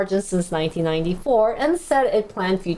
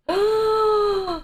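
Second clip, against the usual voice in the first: high sharp intake of breath; shocked gasp; 11 semitones higher than usual. Real, not acted. Streamer gasping at mortgage rates. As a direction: gasp in alarm.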